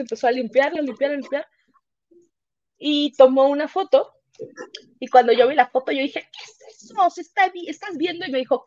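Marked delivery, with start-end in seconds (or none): 6.2-8.3 high, startled questions about the photo; shocked disbelief. Retold or imitated. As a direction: gasp it out as if seeing a face. Retelling shocked discovery in photo. (asombro, voz aguda)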